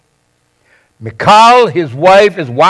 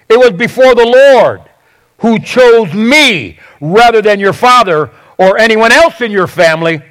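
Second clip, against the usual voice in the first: raised, higher-pitched retort that eases off at the end; defensive; 4 semitones higher than usual. Acted. David giving it right back to his mocking wife, defensive. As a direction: hit back, stressing each claim. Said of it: "he immediately is defensive".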